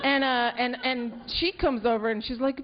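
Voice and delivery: normal talking voice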